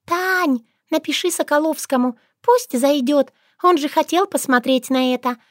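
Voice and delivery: high, gleeful chattering voice; chattering little creature. A narrator's reading. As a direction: gossip gleefully to a friend. Shushana chatters gleefully to Tanya.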